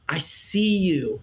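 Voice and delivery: slow, low, heartfelt; sincere reassurance. Acted. Speaking to hurting friends, sincere reassurance. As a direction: let it land gently, slowly and low.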